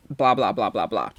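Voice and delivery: low, quiet dismissive trail-off; bored, dismissive blah-blah, sinking in pitch. Real, not acted. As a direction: wave the rest away, dropping low and soft. Cuts short a critic's tweet.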